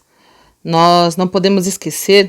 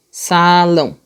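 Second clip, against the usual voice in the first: SALÃO drawn out evenly; slow demonstrative reading; slower than usual. Shown as a demonstration. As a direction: stretch SALÃO for listeners to hear the sound. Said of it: "mesmo som".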